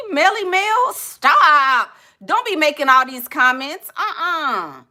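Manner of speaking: high, loud, scandalised protest; scandalised scolding. Real, not acted. Scandalised scolding of nasty comments.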